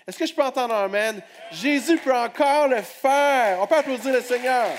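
high, loud and fast; fiery preaching call. Real, not acted. Preacher calls on the crowd for an amen, fired up. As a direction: rouse the church to answer.

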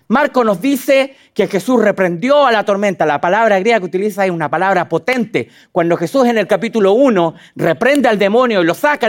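ordinary talk

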